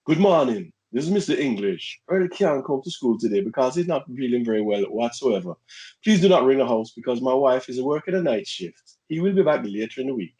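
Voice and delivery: middle-aged Jamaican gentleman phoning in; formal phone call in a put-on Jamaican accent; impersonated Jamaican dad. Shown as a demonstration. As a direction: pose as a Jamaican father ringing the school. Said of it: "do the voice".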